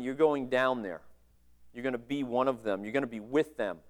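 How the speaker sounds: a father breaking hard news gently; God the Father softly telling the Son he must go; tender quiet father. Retold or imitated.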